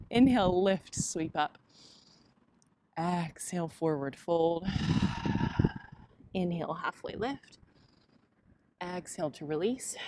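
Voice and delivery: soft, unhurried flow cues; yoga cueing. Acted. Teacher cueing her yoga class, soft and unhurried. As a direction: guide the class through each breath.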